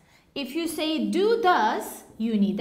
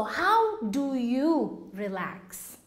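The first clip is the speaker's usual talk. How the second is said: slow model question; 3 semitones lower, much slower than usual. Shown as a demonstration. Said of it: "teacher models slowly".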